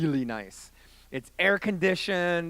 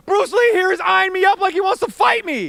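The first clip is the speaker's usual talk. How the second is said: high, loud acted tirade; indignant yelling; 13 semitones higher, 9 dB louder than usual. Young man telling buddy about staring stranger, indignant yell. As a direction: shout the complaint at full pitch. Acted quarrel, shouted complaint.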